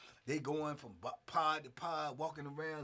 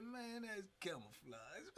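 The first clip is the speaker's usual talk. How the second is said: soft, slow, confiding; the cellmate explaining the act, soft and slow; knowing hush; 2 semitones higher, 14 dB quieter, slower than usual. Retold or imitated. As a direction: let him in on the secret quietly. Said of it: "He said".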